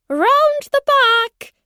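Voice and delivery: high, loud, drawn-out holler; calling out. A narrator's reading. Unseen woman directing visitors round the house, high loud call. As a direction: sing it out to someone far off. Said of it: "A voice called out".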